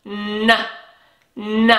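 "N" hummed as a single n sound; isolated sound. Shown as a demonstration. Teacher isolates the last sound for the children. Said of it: "last letter sound".

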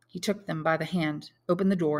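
ordinary delivery

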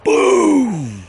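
loud, high drawn-out boom; big booming sound effect; imitated explosion. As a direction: belt out a mock blast, pitch falling off at the end. Imitating the sudden blast he heard.

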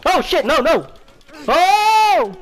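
high, loud panicked outburst; alarmed cry. Real, not acted. Player in trouble during combat, loud alarmed cry.

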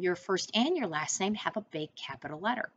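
ordinary delivery

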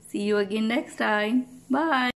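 plain reading voice

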